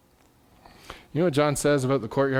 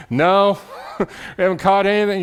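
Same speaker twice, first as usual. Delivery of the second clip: calling back, laughing; 6 semitones higher, 5 dB louder than usual. Acted.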